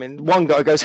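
ordinary talk

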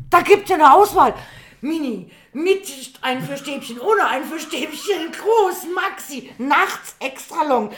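high, loud, drawn-out list of options; acted overwhelmed boyfriend. Boyfriend back from the shop describing the tampon choice, mock overwhelmed. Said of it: "Da ist aber mal auch echt überfordert".

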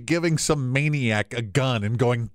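normal talking voice

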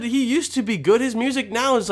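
normal talking voice

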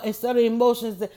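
normal talking voice

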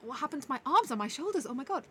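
aching disbelief, hammed up; shocked. Retold morning-after shock.

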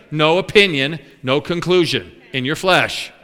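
ordinary talk, nothing marked

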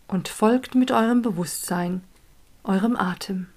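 calm, unhurried guiding voice; guided meditation. Acted. Leads a meditation.

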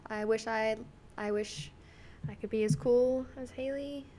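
normal talking voice

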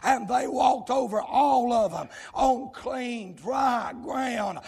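loud, driving revival preacher delivery; shouted sermon cadence; full-force preaching. Real, not acted. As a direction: preach it out hard, phrase by phrase.

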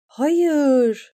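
high, loud desperate scream. A narrator's reading. Eriyen screams as the knight charges, desperate. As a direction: scream it out, too late. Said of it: "diye bağırdı".

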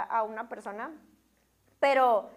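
usual speaking voice

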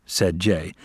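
plain reading voice

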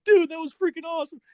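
high, loud, thrilled cheer; teen teammates cheering, high and loud; excited teammates. Retold or imitated. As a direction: hype it up like teammates who just saw a knockout. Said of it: "my team was like".

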